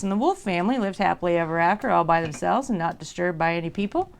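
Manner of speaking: ordinary delivery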